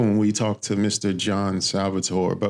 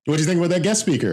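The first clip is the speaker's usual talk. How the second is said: high fast small-talk voice; chatty stranger voice; 9 semitones higher, much faster than usual. Retold or imitated. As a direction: rattle it off high like a chatty coworker. Acting chatty urinal stranger.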